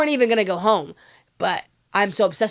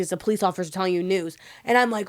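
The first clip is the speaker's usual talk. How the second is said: ordinary delivery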